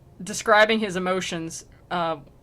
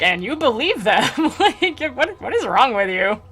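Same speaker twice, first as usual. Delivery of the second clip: louder, laughing disbelief; laughing mock friar; 5 semitones higher, 4 dB louder than usual. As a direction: ask it while cracking up. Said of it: "friar just kinda looks at Virgil like".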